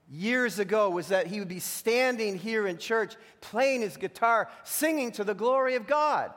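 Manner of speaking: fervent preaching. Acted. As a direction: preach it out to the church with conviction. Preaching to the congregation.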